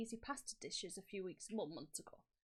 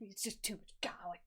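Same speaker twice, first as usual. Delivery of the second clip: mocking the complaint in a whisper. Teasing a friend's garlic complaint, whispered mockery.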